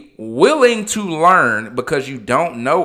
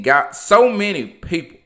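normal talking voice

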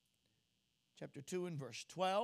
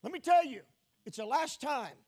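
loud, raised declaration; emphatic apostle's warning; 5 semitones higher, 9 dB louder than usual. Acted. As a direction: proclaim it forcefully as John's warning. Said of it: "And John says".

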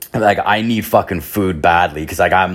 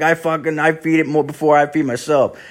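higher put-on voice, earnest about the dog; homeless guy voice; 7 semitones higher than usual. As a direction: keep the raised homeless-guy voice going. Homeless man keeps talking.

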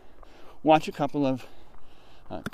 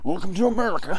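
announcer voice; 6 semitones higher, 5 dB louder, faster than usual. Shown as a demonstration.

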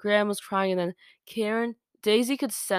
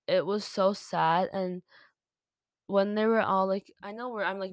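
ordinary talk, nothing marked

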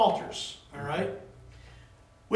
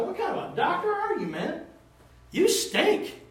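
pitched-up, laughing mock outburst; hot under the collar, half laughing; mock angry retort; 10 semitones higher than usual. Son tells off his mom's careless doctor, laughing. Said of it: "get hot under the collar and say".

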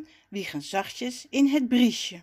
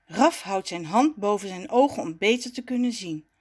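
usual speaking voice

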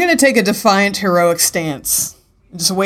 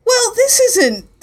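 indignant; 13 semitones higher, much slower than usual. Acted. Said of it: "this isn't fair".

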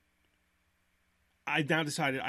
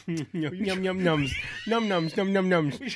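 put-on playful, louder childish num nums; mock yum yum; 3 semitones higher, 5 dB louder, slower than usual. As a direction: smack it like a greedy kid. Mocking his own snack eating.

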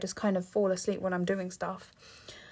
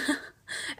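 breathy laugh; 3 dB quieter than usual. Real, not acted. Laughs at own retort.

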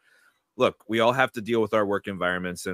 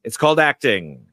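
CALLED hit louder with a falling tune; a dry, emphatic quoted put-down; quoting Gielgud; 7 dB louder than usual. Retold or imitated. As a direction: land hard on the word called, then drop. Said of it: "as John Gielgud said to Dustin Hoffman".

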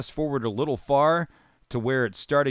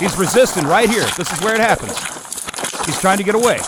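louder, higher, urgent; excited commentary; 5 semitones higher, 6 dB louder, faster than usual. Real, not acted. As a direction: call it out as it happens. Commentator excited during struggle.

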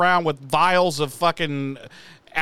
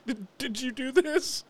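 baffled Giles; 6 semitones higher, 7 dB quieter than usual. Retold or imitated. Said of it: "But he literally says".

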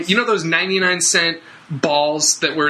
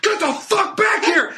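high mock yell after the kids; angry driver yelling; 12 semitones higher than usual. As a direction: shout after them like a furious driver. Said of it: "And he's like".

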